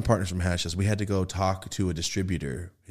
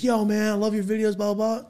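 high, eager fan voice, mimicked; excited fan; 13 semitones higher than usual. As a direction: do a gushing fan walking up. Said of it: "he's like".

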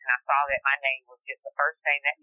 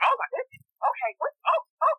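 much higher, imitating a flustered uncle; startled uncle. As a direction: squeak out his alarm as she jumps in his lap. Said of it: "And he was just like".

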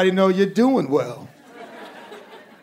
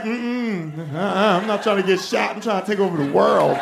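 a hint of a sassy devil; lightly voiced dismissive devil.

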